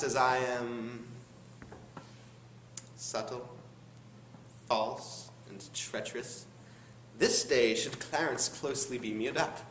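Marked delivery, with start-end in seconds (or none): 3.0-6.5 low and soft, one word at a time; hushed scheming. Acted. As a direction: drop low and quiet, pausing between words. Schemer names his own wicked traits.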